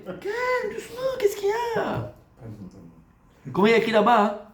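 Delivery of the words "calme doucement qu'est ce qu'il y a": very high, soft squeaky voice; mock soothing. Rabbi asking for calm after agitation, mock soothing. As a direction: plead for calm in tiny falsetto.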